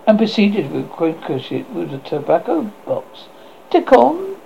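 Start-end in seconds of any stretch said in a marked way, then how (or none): 3.7-4.4 high, sharp cry of a name; high sharp call. A narrator's reading. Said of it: "cried she, in a high, sharp tone".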